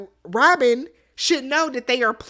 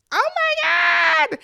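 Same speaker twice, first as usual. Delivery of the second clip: shocked shriek; slower than usual. Real, not acted. Reacting to Boston Rob's sellout remark with a shriek. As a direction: scream it out in disbelief.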